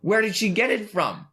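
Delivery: high, loud disbelieving question; real surprise, voice jumping high; incredulous. Real, not acted. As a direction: pitch it up in disbelief. Incredulous question in movie chat.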